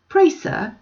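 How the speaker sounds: put-on high, eager old woman's plea; old country woman. A narrator's reading. Old woman stopping Stephen in the street, eager and high. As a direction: stop a stranger politely, reaching up.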